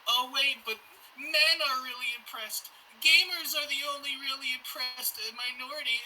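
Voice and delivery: high-pitched mocking complaint; mock victim whine. Podcaster parodying gamers who claim oppression, high mock complaint. Acted quote of self-styled victims.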